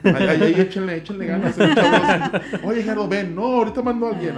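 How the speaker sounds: loud, high, laughing orders to the crew; hammed-up loud boss. Acting out the leader urging his crew, loudly and laughing. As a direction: play the boss barking cheerful orders. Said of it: "Ahí actúen".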